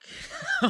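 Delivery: high squealing laugh; burst of laughter. Real, not acted. Laugh after the dread.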